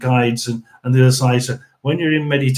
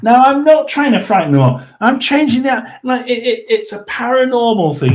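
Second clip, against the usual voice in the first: high, indignant denial; 9 semitones higher than usual. Real, not acted. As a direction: voice jumping up high to object. Denies trying to frighten them off.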